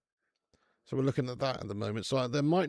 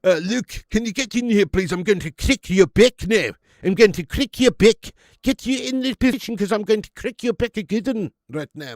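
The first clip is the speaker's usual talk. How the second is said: put-on loud, high South African accent; 8 semitones higher, 11 dB louder than usual. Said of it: "if you had a really South African accent".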